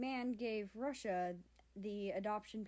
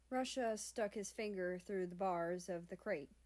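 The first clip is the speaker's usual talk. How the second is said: plain reading voice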